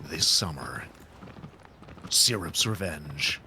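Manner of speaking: low, slow movie trailer narration; horror trailer voice. Pretend. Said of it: "do the trailer voice".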